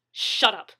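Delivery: snapping. Acted. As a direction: snap it at him. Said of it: "the man snapped".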